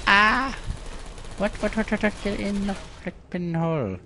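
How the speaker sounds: high, frantic yelling at the game; frustrated high yell; panicked outburst. Real, not acted. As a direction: shriek in alarm.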